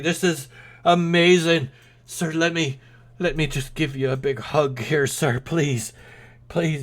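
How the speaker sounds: high, loud pleading gush; overjoyed beggar. Acted. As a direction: beg for a hug in delight. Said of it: "and he goes".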